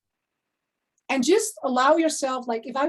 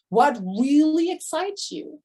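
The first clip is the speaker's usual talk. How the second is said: normal talking voice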